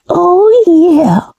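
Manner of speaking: usual speaking voice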